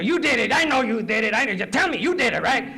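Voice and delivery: high, loud, badgering bad cop. Bad cop pushing an arrested suspect to confess, forceful and high. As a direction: hammer the accusation out like a bad cop. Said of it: "The bad cop comes around".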